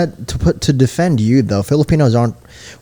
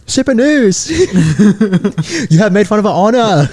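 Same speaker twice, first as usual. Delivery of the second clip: higher, mock Chinese gangster voice; mock triad accent; 9 semitones higher, 4 dB louder than usual. Joking that triads come after them, mock Chinese accent. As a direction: play an offended triad boss with a put-on accent. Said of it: "What if the triads come after us?".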